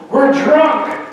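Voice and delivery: put-on high, silly little-boy voice; little kid. Four-year-old answering his dad from the bathtub, squeaky and cheeky. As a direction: play a giggly four-year-old, proud of it. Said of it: "the four-year-old goes".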